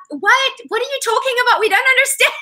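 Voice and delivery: high incredulous what; baffled locals. Retold or imitated. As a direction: play the confused South Africans. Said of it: "they were like".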